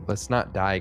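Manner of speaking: usual speaking voice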